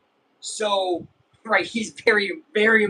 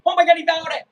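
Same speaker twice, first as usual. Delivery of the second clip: high, loud pretend outrage; hammed-up exasperated yell; mock mad outburst; 8 semitones higher, 6 dB louder, faster than usual. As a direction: blow up jokingly at the play. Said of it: "now I'm getting mad".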